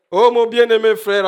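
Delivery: a hint of a pompous preacher calling out; preacher addressing brothers. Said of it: "le pauvre prédicateur continue à prêcher". (le prédicateur, un peu plus fort et aigu)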